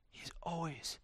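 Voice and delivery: awed whisper; hushed reverent whisper. Acted. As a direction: breathe it in wonder.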